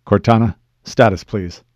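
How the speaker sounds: loud, brisk call for status; firm request. Acted. Master Chief asks his AI for status, firm and loud. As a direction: raise your voice to demand a report.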